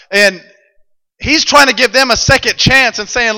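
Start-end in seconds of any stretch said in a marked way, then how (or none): none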